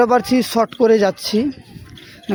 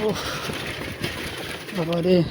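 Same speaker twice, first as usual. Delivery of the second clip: on a bumpy road; 2 semitones lower, 10 dB quieter than usual. Real, not acted. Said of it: "প্রচুর রাস্তা খারাপ".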